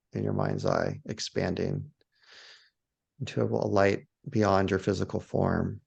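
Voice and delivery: low, spacious meditation voice with long pauses; guided meditation. Shown as a demonstration. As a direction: lead them gently outward, one phrase at a time. Leads a guided awe meditation.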